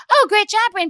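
high, bright praise from a parent; gushing parent. Retold or imitated. Another parent praising Bryn, high gushing. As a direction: gush like a proud other parent. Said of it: "friend's parents who says".

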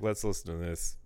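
low-key, quiet scorn; condescending. Acted. Imagined big-time comics sneering at amateurs, quietly condescending. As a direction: softly talk down about them. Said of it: "they would be doing it condescendingly".

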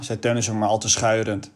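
usual speaking voice

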